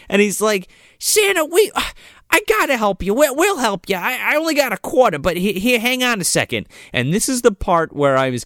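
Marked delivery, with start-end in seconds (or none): 1.0-6.7 high-pitched imitation of an eager kid; earnest little boy. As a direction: pitch it up like a child rushing to help. Voices little Jimmy offering help.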